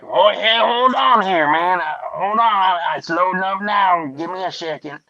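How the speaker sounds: stalling hillbilly caller; high put-on hillbilly voice. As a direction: play a flustered hillbilly buying time. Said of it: "I played the regular American hillbilly".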